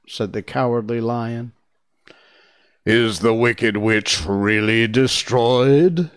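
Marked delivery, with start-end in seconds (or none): 2.8-6.1 slow, loud booming question with a slight waver; big voice, faintly unsettled; a little trembling. A narrator's reading. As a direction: draw the question out loudly, a touch uneasy. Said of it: "Dorothy thought it trembled a little".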